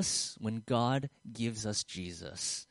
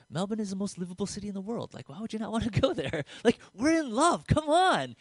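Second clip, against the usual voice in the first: pleading sales pitch; 8 semitones higher, 4 dB louder than usual. Retold or imitated. Retells his repeated pleading argument.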